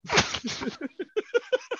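high-pitched laughing fit; real laugh. Real, not acted. Storyteller cracking up at a friend's quip, high laugh. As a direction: laugh helplessly at the joke.

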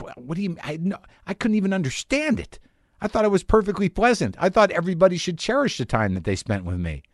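sputter, then protest as if deeply wronged; mock-wounded bewilderment, voice pushed up; baffled disbelief. Retold disbelief at being kicked out.

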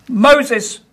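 high squawked bird answer; parrot voice. Acted. Parrot telling the burglar its name in a high squawk.

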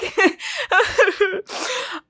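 high laugh breaking into crying. Real, not acted. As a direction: laugh and sob at once.

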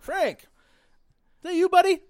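loud, high call to a buddy; excited calling out. Retold or imitated. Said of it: "He's like".

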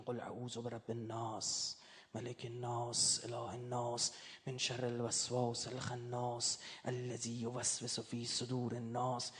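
slow, low, measured Arabic recital; drawn-out verse endings, chanted evenly; Quran recitation. Acted. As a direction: recite the sura solemnly on a low, even pitch.

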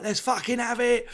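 high, rowdy pub shout; rowdy drunk at night. Retold or imitated. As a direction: bellow it like a lad out on the lash. Drunk night-time Brits.

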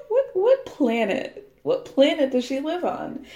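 disbelieving, slowed question; incredulous. Real, not acted. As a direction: stretch out the disbelief. Incredulous outburst.

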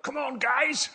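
high, loud mock South African cheer; South African accent. Preacher quoting a rousing guest speaker, rough South African accent. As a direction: imitate her rousing South African call. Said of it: "I can't do the South African accent well enough".

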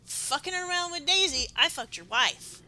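loud, high, hammed-up jab; mock shouted taunt. Co-host acting out the bandmate's taunt, loud and mocking. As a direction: yell the dig like you mean to wound. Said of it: "why he wouldn't have said".

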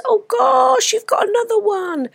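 high cooing over a puppy; squealing over a cute puppy. Real, not acted. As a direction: coo at the dog in a high voice.

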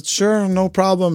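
breezy, unbothered and slow; nonchalant. Retold or imitated. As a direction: toss it off like it's nothing. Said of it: "like, fully nonchalant".

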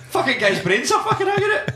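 high, laughing disbelief. Real, not acted. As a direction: laugh through the words. Laughing at gory scene.

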